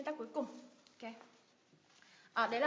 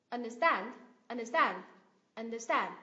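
"understand" as under-STAND; teacher's model of "understand", weight on STAND; stress on second part; 4 dB louder than usual. Shown as a demonstration. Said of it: "nhấn vào thành phần thứ hai".

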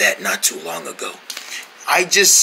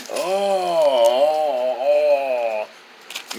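pleased low groans; 7 semitones lower, 5 dB quieter, much slower than usual. Acted. Massage pleasure.

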